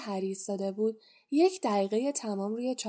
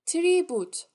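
name called out high and loud across the hall; calling out a name; 7 semitones higher, 6 dB louder than usual. A narrator's reading. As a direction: announce the name loudly to the hall.

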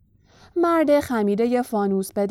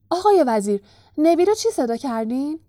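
higher, louder question; excited; 5 semitones higher, 5 dB louder than usual. A narrator's reading. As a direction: blurt it to the minister excitedly. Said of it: "با هیجان گفت".

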